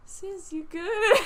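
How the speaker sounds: high, loud, drawn-out question; incredulous check-in. Retold or imitated. Worker checking on her yelling coworker, amused disbelief. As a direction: stretch it out in mock concern.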